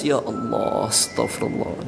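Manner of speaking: low, hushed mock-weepy plea; feigned tearful dhikr. Preacher mimicking a man faking tears in prayer, mock weeping. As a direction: imitate a put-on sob of repentance, slowly breathed out. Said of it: "ketika dia berzikir dia menangis".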